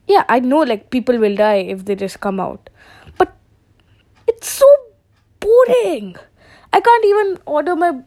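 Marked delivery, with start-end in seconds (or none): 3.2-8.0 much higher, louder whine; whiny high complaint. Real, not acted. Complaining about lockdown.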